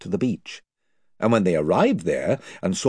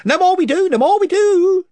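much higher, louder sing-song echo; chiming chorus; 9 dB louder than usual. Acted. Followers echoing their chief, high and louder. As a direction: chime in all together. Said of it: "chimed in the others".

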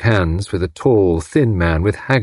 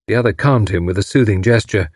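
usual speaking voice

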